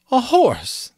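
high, loud, offended exclamation; indignant. A narrator's reading. Insulted unicorn repeats the word.

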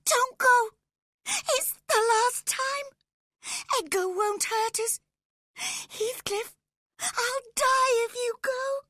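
hysterical high-pitched begging; desperate tearful shrieking; screaming. A narrator's reading. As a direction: scream and beg him to stay. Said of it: "she screamed".

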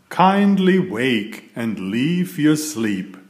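ordinary delivery

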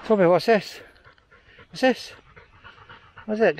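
high sing-song voice for a dog; high pet voice. Real, not acted. Talking to the dog.

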